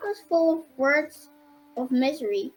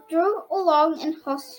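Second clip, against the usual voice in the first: ordinary delivery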